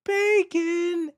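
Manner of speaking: Bacon. in a very high squeaky comic voice; high mock-ecstatic Bacon; comedian impression. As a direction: imitate Jim Gaffigan's high silly Bacon. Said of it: "which stand-up comedian was just like".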